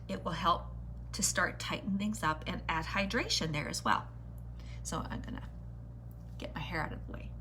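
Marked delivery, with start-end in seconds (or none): 4.8-7.3 whispering. Real, not acted. Aside while doing hair.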